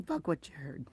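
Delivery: muttered low and soft; dropped to a low murmur; under the breath. Shown as a demonstration. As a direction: say it quietly to yourself. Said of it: "you can just say to yourself".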